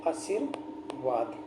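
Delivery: आशीर्वाद very slow and soft, in two separated parts; low, drawn-out आशीर्वाद, dictation style; broken into parts. Shown as a demonstration. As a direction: sound out आशीर्वाद, pausing before वाद. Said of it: "कैसे लिखा जाता है".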